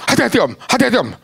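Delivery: high-pitched mock warning cry; urgent, high imitated warning; retold panicked yell. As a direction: imitate a nervous passenger crying out. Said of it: "dia teriak".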